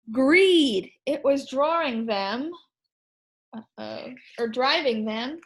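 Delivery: lively rhyming read-aloud; storybook read-aloud. Acted. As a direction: read the poem to the class.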